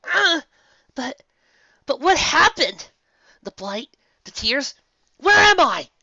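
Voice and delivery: high, loud, frantic; frightened pony near a shout; panicked questions. Acted. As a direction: fire off questions in rising panic.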